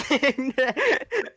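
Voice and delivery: high, laughing echo of the joke. Real, not acted. Co-caster echoing partner's chat meme, laughing. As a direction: laugh the words out.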